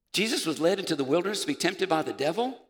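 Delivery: puzzled, rising question; incredulous. Acted. Preacher re-reading the verse as if shocked, incredulous rising question. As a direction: question the verse in mock disbelief.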